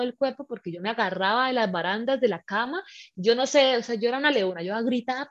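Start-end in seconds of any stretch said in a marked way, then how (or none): none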